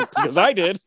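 laughing. Retold or imitated. Admitting he screamed too.

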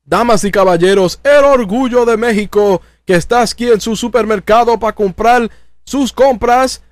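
Spanish ring announcer, building to a big high shout; grand ring introduction rising to a stretched yell on Del Rio; Ricardo Rodriguez ring announcer. Retold or imitated. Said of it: "Ricardo walks in".

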